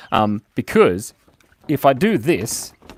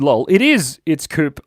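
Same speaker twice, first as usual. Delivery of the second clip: normal talking voice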